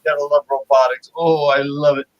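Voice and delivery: loud fast gushing; excited raving. Real, not acted. Host raving about robots, loud and fast. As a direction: rave about it.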